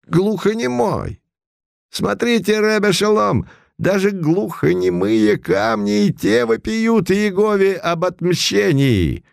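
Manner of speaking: high, solemn prophet's voice; solemn pronouncement. A narrator's reading. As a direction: proclaim it like a temple elder. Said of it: "торжественно произнёс Мордухай".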